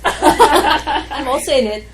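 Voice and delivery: I'm also in it said through a big laugh. Real, not acted. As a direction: laugh as you say it, slowly.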